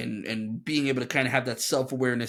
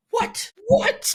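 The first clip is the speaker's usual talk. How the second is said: incredulous what; 5 dB louder than usual. Retold or imitated. Shocked reaction to Aslan's calm.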